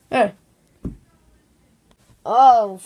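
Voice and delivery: ordinary talk, nothing marked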